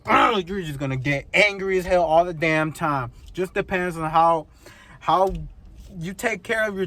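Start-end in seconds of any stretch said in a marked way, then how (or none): 0.1-0.5 short, higher-pitched growl of frustration; frustrated groan. Acted. Owner fed up with the truck, frustrated groan. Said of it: "you are just gonna go".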